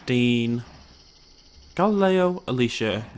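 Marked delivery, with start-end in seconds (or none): 1.7-3.0 higher, louder call of the name; emphatic call. Real, not acted. Calling his dinosaur by name, emphatic. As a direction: call it out across the pen.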